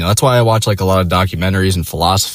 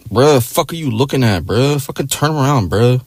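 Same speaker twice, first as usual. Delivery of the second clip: lightly put-on street-slang accent, a bit higher; mock street-slang accent; 5 semitones higher than usual. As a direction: imitate a tough guy's street-slang accent.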